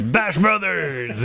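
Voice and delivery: high, drawn-out mock war cry; battle cry. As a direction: stretch it out like a gleeful rallying call. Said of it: "and he goes".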